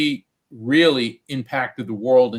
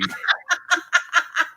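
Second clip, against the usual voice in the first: a burst of high-pitched laughter. Real, not acted. Laughing on air at Shirley's joke, high and delighted.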